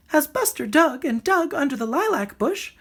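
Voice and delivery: high little bird voice reading a story; bird reading aloud. A narrator's reading. The bird reading the dog story to Rocket, high and lively. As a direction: read it like a lively songbird storyteller. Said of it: "the bird read".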